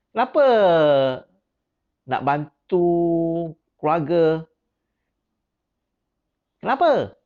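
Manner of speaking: high, loud, pressing "Kenapa nak bantu keluarga?". Shown as a demonstration. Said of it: "Saya punya intonasi dah, dah menurun sikit".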